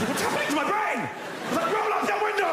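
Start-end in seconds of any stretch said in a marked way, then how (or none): 1.7-2.5 dad shouting. Retold or imitated. Dad ordering the children in the car to shut the window. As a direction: bark it over motorway noise.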